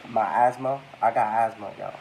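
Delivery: ordinary talk, nothing marked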